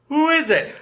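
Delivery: high shouted question from afar; Larry yelling back. Retold or imitated. Larry shouting from another room, asking who called. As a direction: call out grumpily from another room.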